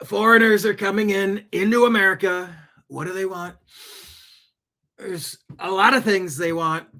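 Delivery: politician impression. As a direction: ham up a rambling campaign-rally speech. Mock politician rant on foreigners.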